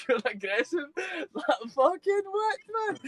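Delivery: high-pitched laughing fucking what; laughing disbelief. Retold or imitated. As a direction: laugh it off in shock. Laughing shock at the verdict.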